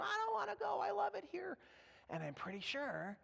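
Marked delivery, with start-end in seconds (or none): 0.0-1.6 high, soft mock-wail of a child; crying tantrum. Son refusing to leave grandma's, high mock-crying. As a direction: wail like a kid who won't leave. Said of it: "he cries and throws a tantrum".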